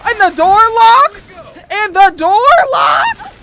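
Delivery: high, loud alarmed question; panicking as the door locks them in; panicked. Real, not acted. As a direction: shriek in dismay. Trapped with a monster.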